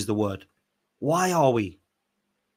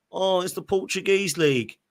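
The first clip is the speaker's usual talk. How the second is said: higher-pitched mock dismissal; mocking objection; 6 semitones higher than usual. Streamer mimicking doubters of Portuguese football, mocking.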